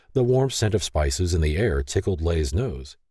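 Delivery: usual speaking voice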